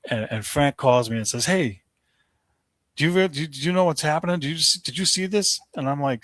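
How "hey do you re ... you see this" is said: higher-pitched, stumbling urgent questions; agitated, high-pitched mock caller; urgent retold phone call. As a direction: imitate a worked-up friend calling with news.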